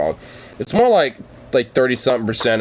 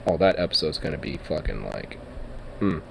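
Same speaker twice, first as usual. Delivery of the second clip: usual speaking voice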